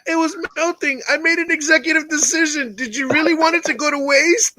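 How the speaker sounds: high, sheepish excuse in mock innocence; excuse-making voice. Teasing a friend by voicing his guilty excuse about the cake. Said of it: "She's like".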